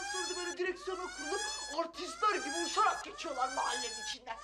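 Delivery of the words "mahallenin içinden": high, indignant outburst; indignant shout. Acted. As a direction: shout it out in disbelief. Complaining about reckless drivers.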